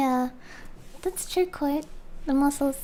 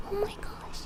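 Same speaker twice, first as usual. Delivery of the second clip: high whispered gasp; whispered oh my gosh; 6 semitones higher, 11 dB quieter than usual. Real, not acted. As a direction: whisper it in dismay. Reacting to mower noise.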